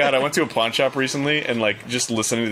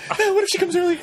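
high panicked voice; much faster than usual. Retold or imitated. Panicked inner thought.

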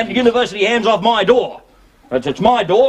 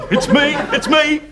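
high, eager claim; blurting it's me in greedy excitement; excited; 8 semitones higher than usual. Acted. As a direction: jump in before he finishes. Again interrupts excitedly.

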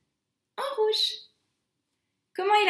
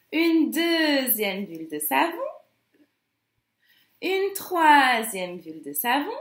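consignes chantonnées, avec bruitages; étirer les mots-clés comme pour mimer chaque geste; comptine mimée. Acted. Chants a hand-washing routine. (comptine gestuelle pour petits, en français)

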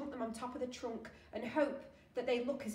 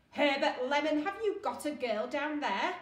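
loud, raised accusing question; stern demand; 4 semitones higher, 8 dB louder than usual. Acted. Hotel manager turning on the attendant, loud accusing demand. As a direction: turn on him and demand an answer.

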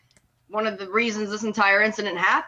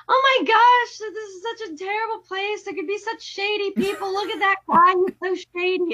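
much higher, ditzy nervous voice; mock scared girl; 11 semitones higher than usual. Mocking the heroine in the subway, high fretful voice. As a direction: fret about shady people in a high voice. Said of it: "she's like".

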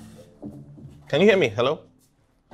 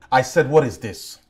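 louder, higher, irritated repeat; raised voice, demanding; insistent demand; 5 semitones higher, 5 dB louder than usual. Acted. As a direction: press hard for an answer.

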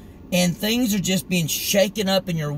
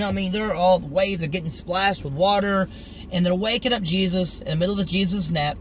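ordinary talk, nothing marked

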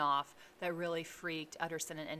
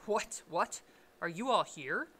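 mildly peevish, a little louder; 6 dB louder than usual. A narrator's reading. Lawyer finding all the servants gathered, peevish. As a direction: ask it testily, with some push. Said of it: "said the lawyer peevishly".

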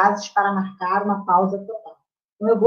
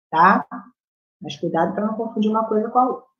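usual speaking voice

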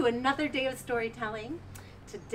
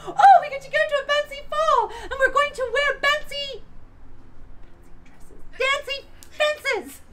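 much higher and louder, giddy; squealing sisters; 7 dB louder than usual. Acted. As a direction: squeal it like a silly excited stepsister. Sisters excited about ball.

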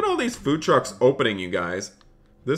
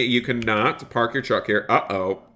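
ordinary delivery

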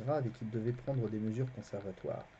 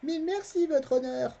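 put-on young boy Garion; 7 dB louder than usual. A narrator's reading. Garion voiced.

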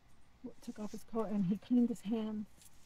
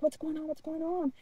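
high-pitched repeated question; retold panic; 6 semitones higher than usual. Abductee asking the alien for answers, panicked. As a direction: plead for answers, still freaking out. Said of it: "I'm still freaking out".

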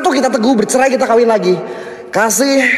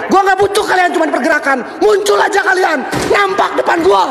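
taunting shout; 8 semitones higher than usual. Real, not acted. Ghost hunter taunting the spirits, shouting. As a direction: yell out to provoke the ghosts.

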